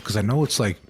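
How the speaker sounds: ordinary talk, nothing marked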